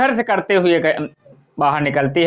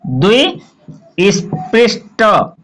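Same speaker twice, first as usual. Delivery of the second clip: द्विस्पृष्ट drawn out very slowly in separate pieces; slow classroom dictation of द्विस्पृष्ट, piece by piece; syllable by syllable; 3 semitones higher, 3 dB louder, much slower than usual. Shown as a demonstration. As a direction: break द्विस्पृष्ट into द्वि, स्, पृष्, ट with pauses. Teacher breaks term into syllables.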